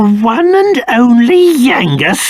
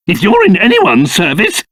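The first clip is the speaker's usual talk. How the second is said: ordinary delivery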